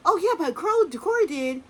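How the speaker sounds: high, loud gushing parent voice; mock bragging mom. Vlogger mocking Kim boasting about her son. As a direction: parody a mom who turns it to her kid.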